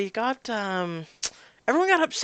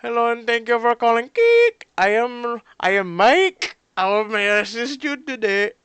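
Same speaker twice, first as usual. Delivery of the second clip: higher, louder Indian tech-support voice; Indian accent; 6 semitones higher, 9 dB louder than usual. Acted. Indian tech support agent greeting a caller, overly polite. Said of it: "calling tech support".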